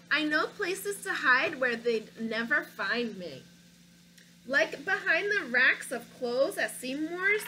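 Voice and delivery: put-on lively read-aloud for children; story narration. A narrator's reading. Reading a picture book to children, lively storybook narration. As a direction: tell it like a picture book to kids.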